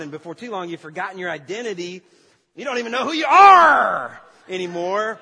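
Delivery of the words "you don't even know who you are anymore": loud, high hammed-up cry on ARE; over-the-top outburst. Preacher overdoing Josh's lost identity, hammed-up holler. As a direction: push it way too far, comically overblown. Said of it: "gone too far with that one".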